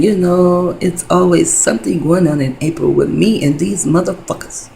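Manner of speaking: ordinary delivery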